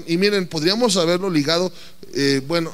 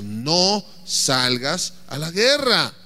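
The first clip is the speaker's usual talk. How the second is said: slow solemn warning; 4 semitones lower, much slower than usual. Acted. Preacher repeating God's warning.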